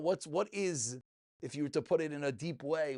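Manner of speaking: usual speaking voice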